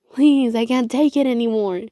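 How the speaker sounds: pleading. A narrator's reading. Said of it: "Seeker pleaded".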